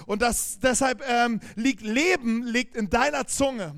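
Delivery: loud, driving sermon voice; preaching at full force. Acted. Pastor urging the congregation to bless, loud preaching. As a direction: preach it with conviction to the church.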